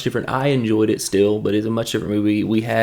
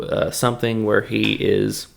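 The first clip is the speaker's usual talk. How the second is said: ordinary delivery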